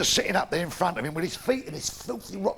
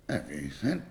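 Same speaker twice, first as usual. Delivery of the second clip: very low, soft leering voice; deep leering vicar; 7 dB quieter than usual. Acted. Vicar answering the girl, very low and quiet. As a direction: drop deep and slimy as the vicar.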